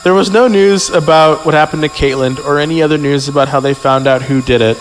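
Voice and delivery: ordinary talk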